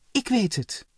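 high, loud delighted cry; light up with the idea; beaming exclamation. A narrator's reading. Ellen struck by a bright idea, delighted cry. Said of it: "riep ze toen met een stralende blik".

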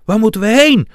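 loud, high, panicked yell; shout. A narrator's reading. Loppie fleeing a fireball, shouting in panic. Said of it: "schreeuwde Loppie".

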